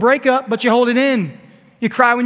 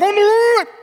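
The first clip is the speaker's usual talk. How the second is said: very high wailed mumble; muffled mummy; 12 semitones higher, 4 dB louder than usual. Acted. Preacher as wrapped Lazarus trying to speak, muffled mummy voice. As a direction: cry out through burial wrappings. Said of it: "a mummy-like figure who's like this".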